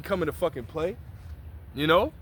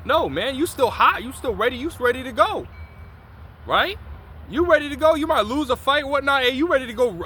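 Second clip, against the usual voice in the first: high and loud, itching for a rematch; fired up; 8 semitones higher, 3 dB louder than usual. Retold or imitated. Preacher acting out a wronged man still ready to fight, fired up. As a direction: hype yourself up to fight again. Imitating someone fired up for revenge.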